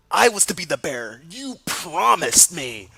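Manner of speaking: angry confrontation. Acted. Albus accuses angrily.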